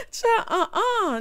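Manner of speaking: slow, high words through laughter; laughing. Real, not acted. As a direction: laugh while saying it. Laughing at own story.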